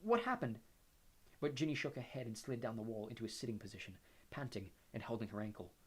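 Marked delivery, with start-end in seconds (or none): 0.0-0.6 fearful. A narrator's reading. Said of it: "Harry said fearfully".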